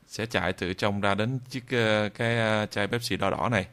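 ordinary talk

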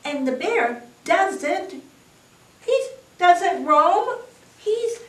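high, anxious hare voice, haltingly; hare crying out. A narrator's reading. Hare frets over Bear out in storm, crying out high. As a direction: cry it out high as a worried hare. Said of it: "cries Hare".

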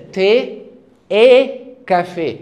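"تي اي كافيه" linked smoothly in French, slow and loud; teacher linking "تي اي كافيه" for the class; French liaison demo. Shown as a demonstration. Teacher models "تي اي كافيه" slowly and loudly, linked in French. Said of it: "هقول كده".

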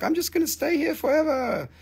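high, softened cartoon voice; little moon guy. Little guy drowning in the stone, high-voiced and playful. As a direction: voice a tiny figure way up high. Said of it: "and he's like".

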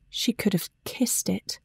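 soft tender whisper. A narrator's reading. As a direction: breathe it as a secret. Tender private moment.

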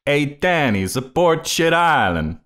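high, slow playful holler; calling out. Pretend. Host hollering to Danny DeVito for support, playful calling out. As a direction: shout it out as if to catch a celebrity's attention.

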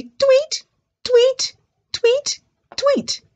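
four very high imitated tweets; high, short birdie peeps. Shown as a demonstration. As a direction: chirp four times like little birds. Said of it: "and the birds say".

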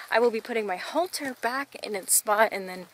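ordinary delivery

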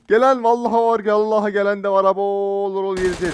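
loud, high alarmed calling out; loud, urgent gaming warning; excited alarm. Real, not acted. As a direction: shout the warning as enemies close in. Enemies approaching during the match.

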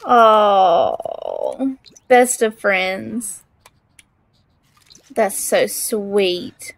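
drawn-out tender aww; sympathetic aww. Real, not acted. Cat eating, owner gushing.

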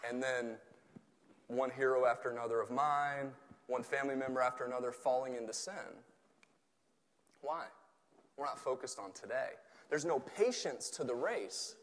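usual speaking voice

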